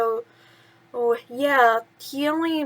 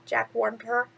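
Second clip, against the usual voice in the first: usual speaking voice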